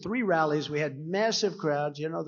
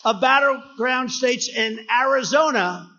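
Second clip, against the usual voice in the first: higher, louder rally boast; rally shout; 6 semitones higher, 7 dB louder than usual. Real, not acted. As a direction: announce the poll to the crowd. Rally boasting poll numbers.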